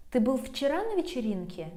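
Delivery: quick question peaking on "вчера"; stress on ВЧЕРА. Shown as a demonstration. Forgetful friend asking was it yesterday, question stressing "вчера". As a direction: raise "вчера" sharply, drop through the rest. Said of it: "if it was yesterday and not".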